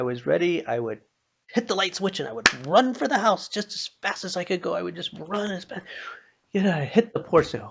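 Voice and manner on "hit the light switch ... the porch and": higher, louder, quick panicked rush; breathless fear. Retold or imitated. Preacher reliving a child's dash from the barn, breathless. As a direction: tell it like a kid fleeing the dark.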